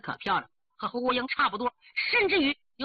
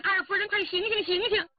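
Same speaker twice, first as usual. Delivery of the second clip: high-pitched shout to rouse someone; urgent calling; 7 semitones higher than usual. A narrator's reading. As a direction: call sharply to wake a fainted mistress. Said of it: "这回扯着耳朵喊". (急切高声呼唤)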